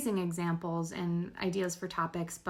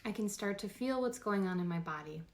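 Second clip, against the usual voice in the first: guided meditation; 5 dB quieter than usual. Real, not acted. Host guiding listeners through a body scan, calm meditation voice. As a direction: talk them gently through the body, unhurried.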